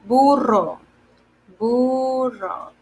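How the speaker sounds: "burro" with a strong rolled RR; rolled double R. Shown as a demonstration. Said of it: "like a little, uh, exaggerated, the R sound".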